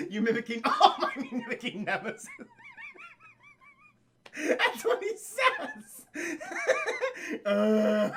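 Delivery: real high-pitched laughing speech; helpless laughter. Real, not acted. Streamer defending his mimicry to chat, helpless laughter. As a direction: squeeze the words out through giggles.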